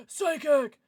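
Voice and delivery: loud, eager burst; excited guess. Real, not acted. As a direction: call it out with sudden conviction. Second, excited take of the guess.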